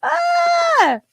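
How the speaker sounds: a high, loud squeal of delight; delighted shriek. Real, not acted. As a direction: let out a thrilled shriek. Squealing at baby romper.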